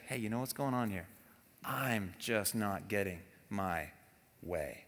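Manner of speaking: gentle, humble admission, almost fading; soft confession. Shown as a demonstration. Spouse calmly confessing mid-conflict, soft and low. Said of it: "And then you say".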